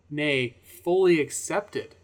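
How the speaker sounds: slow, deliberate emphasis. Real, not acted.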